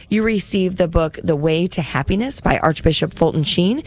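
usual speaking voice

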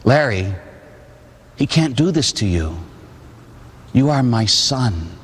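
low, measured, long pauses; steady reassurance after frantic screaming; calm voice of God. Retold or imitated. God's calm words in dream.

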